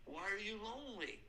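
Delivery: normal talking voice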